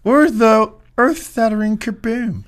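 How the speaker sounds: high, slow Marvin the Martian voice; cartoon alien voice.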